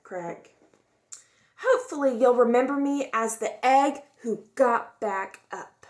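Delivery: expressive storybook read-aloud; gentle, lively storytime voice; reading to children. A narrator's reading. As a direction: tell it like a picture book for kids. Reads picture book aloud.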